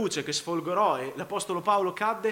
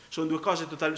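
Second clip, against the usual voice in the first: usual speaking voice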